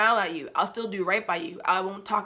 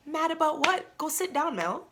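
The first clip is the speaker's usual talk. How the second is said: mock self-scolding; 8 semitones higher than usual.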